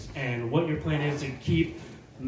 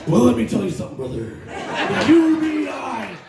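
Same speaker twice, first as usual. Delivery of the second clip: wrestling promo; 5 semitones higher, 4 dB louder than usual. Pretend. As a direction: hype it up like a pro wrestler cutting a promo. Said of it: "Can you cut a wrestling promo for us?".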